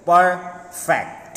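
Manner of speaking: "Perfect" broken as per... fect; split into two syllables. Shown as a demonstration. Teacher showing two syllables, word split with a pause. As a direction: stop in the middle of "Perfect". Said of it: "two time we stop".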